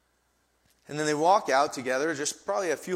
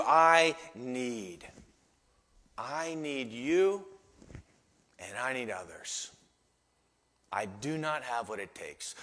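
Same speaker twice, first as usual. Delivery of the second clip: slower, subdued admission; humble dependence; 3 semitones lower, 3 dB quieter, slower than usual. Shown as a demonstration. Believer confessing need to Jesus, humble and slow. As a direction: admit the need quietly, with pauses. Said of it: "needs to shift into".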